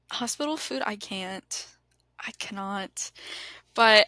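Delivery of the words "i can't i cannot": breathy whisper of disgust; whispered disbelief. Real, not acted. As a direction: whisper it, appalled. Reacts to the hospital food.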